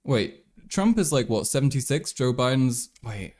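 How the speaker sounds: normal talking voice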